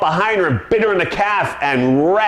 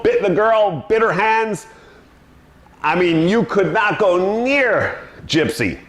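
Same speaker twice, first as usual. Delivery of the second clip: normal talking voice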